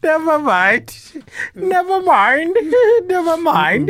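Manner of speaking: high, slow words squeezed through laughter; helpless real laughter. Real, not acted. Host abandons the British attempt, laughing helplessly. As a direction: give up, cracking up as you speak. Breaks up after failed accent attempt.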